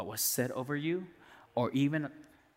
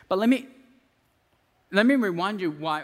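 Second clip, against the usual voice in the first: high, louder build; preaching loud; 5 semitones higher, 8 dB louder than usual. Real, not acted. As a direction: push it out to the congregation.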